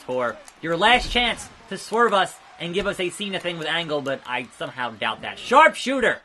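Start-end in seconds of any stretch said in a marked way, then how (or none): 5.4-6.2 loud, high move call; hyped shout of the move; excited callout. Real, not acted. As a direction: shout the finisher name. Calling out game move.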